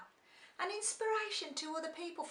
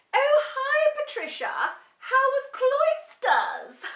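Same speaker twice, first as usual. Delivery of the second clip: much higher, much louder plummy greeting, slow at the end; posh Cotswolds voice; 13 semitones higher, 13 dB louder, slower than usual. Retold or imitated. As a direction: gush like a Cotswolds lady. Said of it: "And now I'm all".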